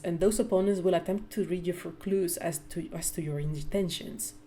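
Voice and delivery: usual speaking voice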